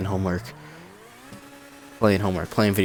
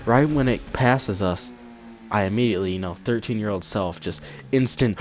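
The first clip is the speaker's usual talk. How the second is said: ordinary delivery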